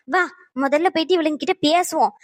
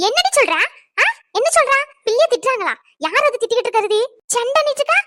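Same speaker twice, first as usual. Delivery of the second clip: alarmed anger; 8 semitones higher than usual. Acted. Mother hearing her daughter was scolded, outraged. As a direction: flare up at the news.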